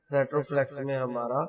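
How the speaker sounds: ordinary talk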